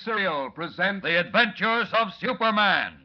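high, loud booming radio announcer; announcer intro. Acted. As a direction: build the excitement like a serial opening. Radio show opening announcement.

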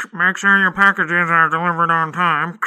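loud announcer voice; booming PA announcement; loudspeaker announcement. Acted.

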